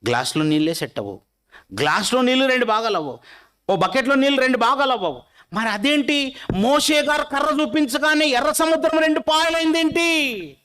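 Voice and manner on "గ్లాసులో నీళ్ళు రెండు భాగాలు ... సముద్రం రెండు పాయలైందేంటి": preaching at full force. Acted. Preacher picturing the crowd for his listeners, ringing and raised. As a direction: proclaim it to the whole congregation.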